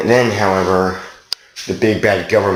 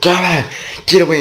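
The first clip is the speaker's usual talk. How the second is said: mock panicked outcry; 10 semitones higher, faster than usual. Storyteller quoting the character who strips nude, mock panicked cry. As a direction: cry it out high as a hammed-up quote. Character voiced fleeing the government.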